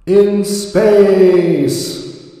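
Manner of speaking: loud, high gleeful cry; booming delight at a trench seen from orbit; excited shout. Real, not acted. Excited about trench from space.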